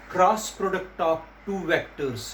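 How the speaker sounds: very slow, word by word; slow dictation. Shown as a demonstration. Teacher dictating the term slowly to students. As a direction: spell it out slowly for the class. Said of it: "teacher slowly dictates key phrase".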